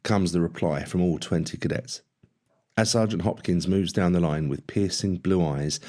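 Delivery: usual speaking voice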